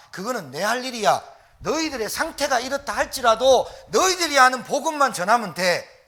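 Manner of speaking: loud, rising sermon climax; full-force preaching. Acted. Pastor urges the congregation to preach, loud fervent preaching. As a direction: drive the message home to the pews.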